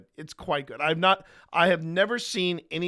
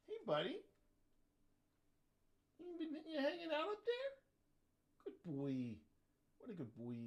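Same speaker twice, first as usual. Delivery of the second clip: high, hushed pet talk; talking to the dog; 13 semitones higher, 15 dB quieter than usual. Real, not acted. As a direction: coo softly up at the dog.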